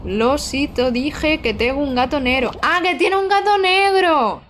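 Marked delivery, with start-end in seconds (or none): 2.6-4.4 high, drawn-out exclamation; delighted realization. Real, not acted. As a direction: react with sudden delighted understanding.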